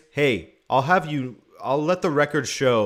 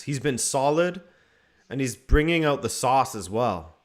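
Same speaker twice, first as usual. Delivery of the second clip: normal talking voice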